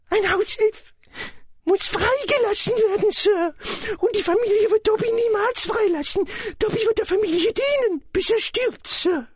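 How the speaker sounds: very high-pitched elf voice; high, earnest creature voice; Dobby, dutiful house-elf. Acted. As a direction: explain it as a servile little elf.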